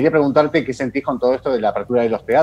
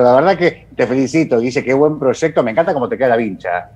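ordinary talk

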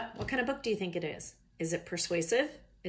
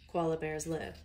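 plain reading voice

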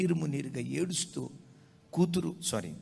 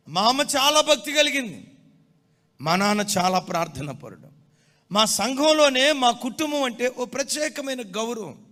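loud, high pulpit proclamation; fervent sermon voice; full-force preaching; 10 semitones higher, 11 dB louder than usual. Acted. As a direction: preach it out to the congregation.

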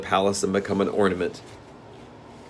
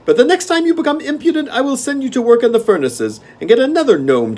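gnome king; 13 semitones higher, 9 dB louder than usual. Acted. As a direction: relish the game like a spoiled monarch.

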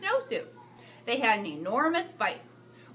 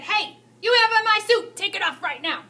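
high, loud, huffy order; an outraged boss demanding his suit back; indignant demand; 8 semitones higher, 7 dB louder than usual. Acted. Principal scolding the teacher over his suit. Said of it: "The principal looked at the teacher and said".

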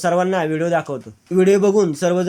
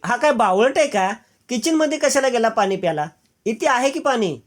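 higher-pitched grumbling disbelief; mildly exasperated; 6 semitones higher than usual. Acted. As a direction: question his silliness, pitch lifted. Asmi grumbles at hero's odd behaviour. (किंचित वैतागून, चढ्या आवाजात)

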